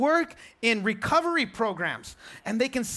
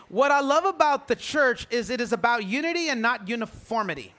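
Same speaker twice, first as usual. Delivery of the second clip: ordinary talk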